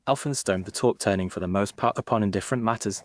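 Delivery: ordinary delivery, nothing marked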